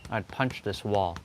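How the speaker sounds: ordinary talk, nothing marked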